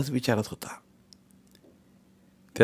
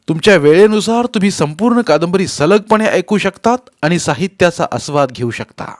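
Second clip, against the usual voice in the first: loud, raised-pitch promotional read; channel announcer; 7 semitones higher, 10 dB louder than usual. Real, not acted. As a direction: announce it to the listeners, high and projected. Channel announcement after the story. (चढ्या आवाजातील जाहीर निवेदन)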